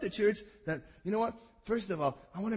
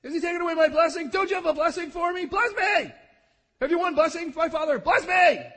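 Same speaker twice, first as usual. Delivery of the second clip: high, loud mock begging; mocking shouted whine; 11 semitones higher, 9 dB louder than usual. Preacher mocking Esau's begging to his father, high shouted whine. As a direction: hammer the whiny pleas. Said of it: "To recap the words of Esau".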